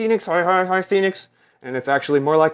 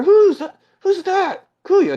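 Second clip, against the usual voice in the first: high excited squeal; 6 dB louder, slower than usual. Pretend. High puppet exclaim.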